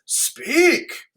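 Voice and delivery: high, demanding "Speak." with a rise; a king prodding his daughter to talk; lightly voiced king, commanding; Lear's command. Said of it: "So he says".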